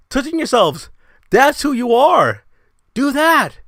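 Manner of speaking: high, loud scornful jab; a shouted taunt at the fans; mocking yell. Real, not acted. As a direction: sneer it out loud.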